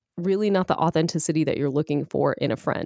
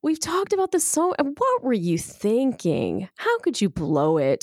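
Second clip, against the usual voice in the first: scolding friend; 7 semitones higher than usual. Acted. Friend scolding Ed for blowing the audition, mock scolding. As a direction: scold him like a disappointed parent. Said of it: "You're being scolded".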